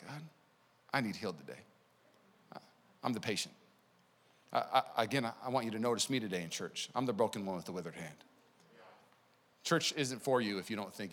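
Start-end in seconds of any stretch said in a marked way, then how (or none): 0.1-8.2 humble prayer. Retold or imitated. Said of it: "And you're like".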